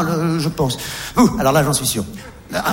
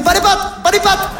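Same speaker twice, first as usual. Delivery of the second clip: higher mock cop order; mock Chilean police; 8 semitones higher than usual. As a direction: bark it like a village cop. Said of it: "elle dit".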